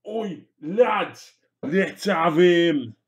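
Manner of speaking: high, loud orc rallying call; orc impression. Orc calling the lads into a fight, rowdy impression. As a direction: holler it to the gang, stretching the last words. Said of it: "Them orc impressions".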